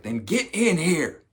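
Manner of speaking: high, drawn-out comic yell; slow, high-pitched beckoning shout; mock eager summons. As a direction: call them over with hammed-up urgency. Acted patient urgently summons helper.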